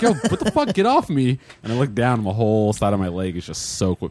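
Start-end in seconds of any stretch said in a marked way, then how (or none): 0.0-1.4 high, startled mock yell at the stranger. Retelling a bar bathroom mishap, high startled mock yell. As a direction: yelp it out like you just got peed on.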